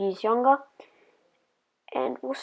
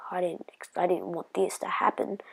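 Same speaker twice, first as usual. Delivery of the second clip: whisper; 2 semitones lower, 8 dB quieter than usual. Retold or imitated. Scared kid after stranger screams.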